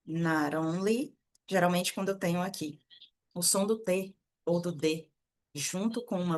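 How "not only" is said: "not only" slowed right down, T tapped like a weak R; slow flapped T. Shown as a demonstration. Teacher repeating not only slowly so students hear the flap. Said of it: "esse sonzinho, né, de R".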